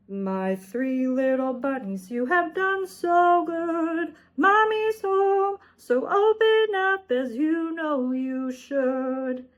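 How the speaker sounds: wolf faking mother rabbit's song; wolf imitating mother's song. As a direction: sing sweetly to trick the bunnies. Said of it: "did his best imitation of Mother Rabbit singing".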